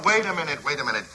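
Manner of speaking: ordinary talk, nothing marked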